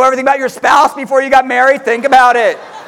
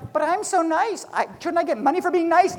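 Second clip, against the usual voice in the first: high, soft pleading; imitating a self-pitying seller; mock whiny nice guy; 7 semitones higher, 6 dB quieter than usual. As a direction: whine it like a hurt nice guy. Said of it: "And you're going".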